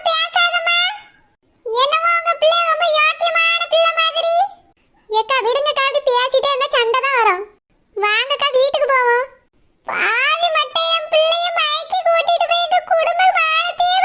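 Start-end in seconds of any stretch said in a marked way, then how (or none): 5.1-9.3 lower, quicker neighbour woman cutting in; a worried neighbour breaking up the fight. Acted. Neighbour pulls her away. (சண்டையை நிறுத்த வரும் பக்கத்து வீட்டுப் பெண், தாழ்ந்த குரலில்)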